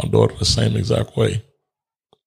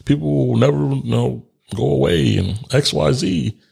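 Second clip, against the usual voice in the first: higher-pitched, lilting recital of received wisdom; recited motto; 6 semitones higher than usual. Retold or imitated. Man quoting the motto he grew up with, lilting recital. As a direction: recite it like a naive old belief. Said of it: "there was a, there was a motto".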